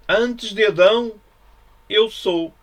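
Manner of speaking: ringing proclamation. Acted. Preacher proclaiming Jesus's words to his listeners, ringing out. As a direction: proclaim it like a revelation.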